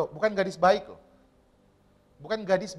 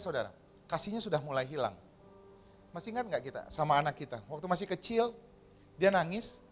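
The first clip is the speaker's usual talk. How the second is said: ordinary talk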